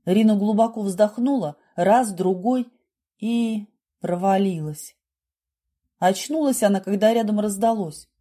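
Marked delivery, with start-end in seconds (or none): none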